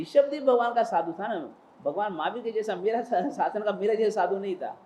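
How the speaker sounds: ordinary delivery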